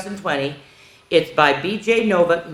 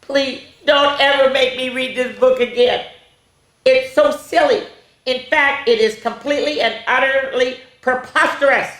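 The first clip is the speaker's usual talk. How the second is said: high-pitched exasperated appeal; mock pleading; 7 semitones higher, 4 dB louder than usual. A narrator's reading. Reader begging never to read this again, comic exasperation.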